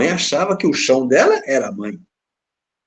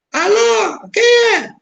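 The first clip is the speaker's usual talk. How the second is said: much higher mock mother's voice on the phone; imitating the mother; 5 dB louder, much slower than usual. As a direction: answer like a humble old mother. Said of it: "a dona atendeu o telefone". (mãe humilde ao telefone, voz bem aguda)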